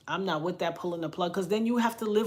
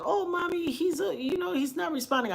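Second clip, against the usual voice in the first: worried daughter; 9 semitones higher than usual. Retold or imitated. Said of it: "it was like".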